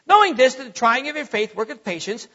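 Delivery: quick run-on recitation; rushed Bible reading. Shown as a demonstration. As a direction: hurry straight through the verse, no weight on any word. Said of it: "we just read. We just say".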